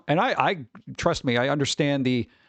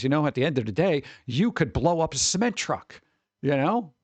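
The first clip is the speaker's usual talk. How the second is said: ordinary talk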